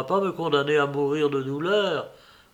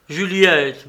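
her name called out louder, falling at the end; loud plea; 3 semitones higher, 9 dB louder than usual. Acted. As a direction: call Juliette out with urgency. Lover pleads, calls her name.

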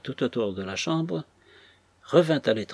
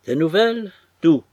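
eager; 5 semitones higher, 8 dB louder than usual. A narrator's reading. Old woman eager for news.

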